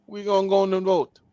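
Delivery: clumsy attempt at a Haitian accent; poor Haitian accent. Voicing offended Haitians deciding to vote, poor Haitian accent. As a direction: have a go at a Haitian accent, badly. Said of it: "That's a horrible accent".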